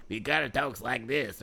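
mock nerdy kid voice from Little Giants; nasal kid imitation. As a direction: quote the glasses kid in a put-on voice. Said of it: "that kid with, like, the glasses".

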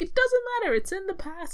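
high-pitched, hammed-up mandrill voice; imitated Rafiki. Rafiki dismisses Simba's complaint, playful high-pitched imitation. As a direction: brush it off in an exaggerated high voice.

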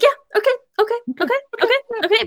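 high-pitched mock agreeing, okay after okay; Marty brushing off criticism. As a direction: imitate someone hurriedly fobbing off a correction. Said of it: "when people say that he's wrong, he's like".

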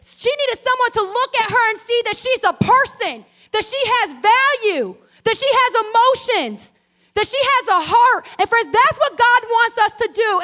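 high, loud, driving sermon climax; full-force preaching. Acted. Pastor drives home the sermon's point to her congregation, preaching at full force.

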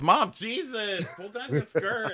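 very high, slow, put-on whine; whiny teen son. Embarrassed son scolding his mom, high whine. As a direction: squeal it like an embarrassed teenage son.

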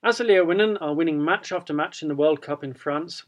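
ordinary talk

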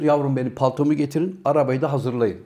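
low, unruffled imitation of a tycoon; understated cool-headed businessman; cold-blooded businessman. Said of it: "soğukkanlı iş adamını oynamış".

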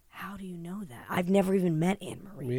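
hushed, stunned whisper; shocked whisper. Retold or imitated. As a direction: whisper it in disbelief. Retelling her shocked reply.

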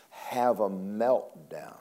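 drawn out, dropping low; slow, hushed emphasis. Acted. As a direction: let it sink in slowly. Preacher slows on key word.

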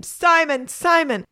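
put-on mum calling after her boy; high, loud call to someone running off. A narrator's reading. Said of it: "his mum calling out behind him".

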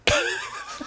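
very high, shrill burst of laughter; squealing laugh. Real, not acted.

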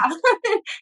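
high, rising wry laugh. Real, not acted. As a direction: laugh at the absurdity. Laughs at the doctors' advice.

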